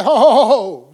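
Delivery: loud "whoa" to cut someone off; acted interjection. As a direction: break in loudly to halt the kids. Acted interrupting of cursing kids.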